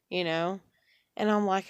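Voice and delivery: ordinary delivery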